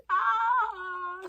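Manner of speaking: high, loud drawn-out wail; mock wail. Teasing the friend as a side chick, mock wail. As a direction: let out a long mock cry.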